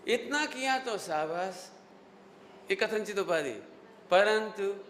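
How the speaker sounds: usual speaking voice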